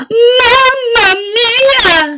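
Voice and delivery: a drawn-out cry of dismay; dismayed mamma mia. Acted. Anthony realises he forgot.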